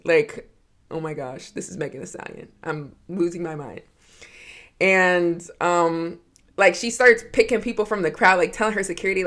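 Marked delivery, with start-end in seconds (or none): 0.9-3.8 hushed, breathless awe; freaking out. Retold or imitated. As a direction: whisper it like you can't believe it. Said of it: "I start freaking out".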